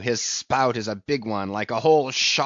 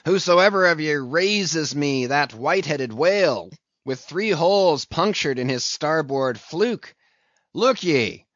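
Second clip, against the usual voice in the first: loud, raised captain's proclamation; exclaiming loudly, pitched up a little; high raised voice; 4 semitones higher, 6 dB louder than usual. A narrator's reading. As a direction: call it out to the whole crew, ringing and emphatic. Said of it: "with a high raised voice exclaiming".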